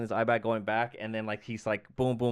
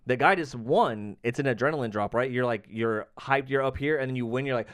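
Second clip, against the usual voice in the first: ordinary talk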